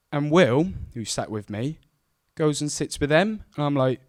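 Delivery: ordinary delivery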